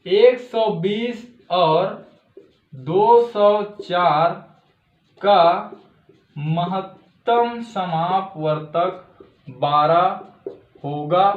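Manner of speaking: very slow, word-by-word dictation with pauses; teacher dictating the final answer. Shown as a demonstration. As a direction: read the answer out slowly so they can write it. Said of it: "आप मन लिख सकत हो".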